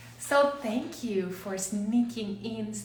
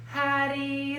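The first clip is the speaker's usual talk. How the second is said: ordinary delivery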